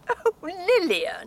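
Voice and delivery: exasperated reproach. Acted. Sister learns she believed him, exasperated. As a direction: scold her with the name.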